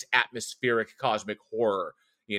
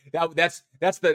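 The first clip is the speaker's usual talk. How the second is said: normal talking voice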